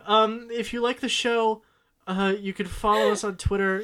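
fighting back giggles; half-laughing through the plug. Real, not acted. Tries plugs while laughing.

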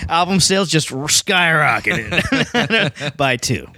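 laughing through a slow joke. Real, not acted. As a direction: chuckle while drawling the punchline. Joking about tiny album sales.

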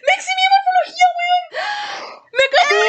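grito agudo, rápido y llorón; gritando eufórica. Real, not acted. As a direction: chilla de la emoción, casi llorando. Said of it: "estoy temblando". (gritando eufórica)

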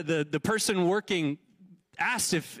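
normal talking voice

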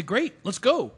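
high, loud and keen; bright-eyed, enthusiastic yes; eager agreement. Acted. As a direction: jump at the plan with a burst of energy. Eagerly agreeing to travel plan.

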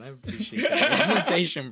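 high, loud, laughing words. Real, not acted. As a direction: break into laughter while talking.

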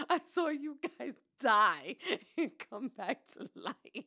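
slow retold cry broken by laughter; drawn-out, laughing melodrama; mock tearful wail. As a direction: laugh through a dramatic retelling. Retold tearful reply, laughing through it.

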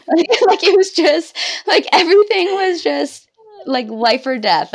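high, loud, laughing through it; real laughter lifting the voice. Real, not acted. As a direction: giggle at her own drama. Laughing about the drama.

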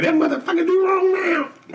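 very high, indignant squeal; hammed-up indignant shriek; mock outraged wife. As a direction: imitate her objecting, way up high. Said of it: "she gonna be like".

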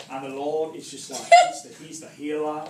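mock English-accented preacher, amused. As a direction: put on a light English accent through a laugh. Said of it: "It's the English anointing".

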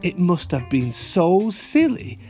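usual speaking voice

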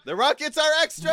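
high, fast, full shout; frantic high-pitched call-out. Acted. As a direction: yell it over roaring rockets. Calling out during mock test drive.

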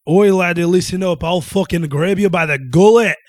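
loud, high mock Scottish threat; Irish or Scottish accent. Joking at work with a threat, put-on Scottish accent. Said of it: "I love doing my accent".

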